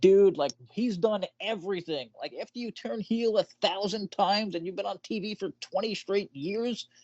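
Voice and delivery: high, exasperated pleading; exasperated retort. Retold or imitated. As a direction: argue it like it's obvious. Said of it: "And I'm like".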